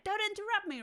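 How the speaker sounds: high, loud mock snap at an interrupter; hammed-up irritated protest; mock blaming. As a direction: play-act blaming someone, pitched well up. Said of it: "wanting to blame that person".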